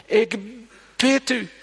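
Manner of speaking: high pleading cry of a desperate father. Retold or imitated. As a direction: beg like a father in need. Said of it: "die vader van die maanzieke jongen". (een hoge smeekbede van een wanhopige vader)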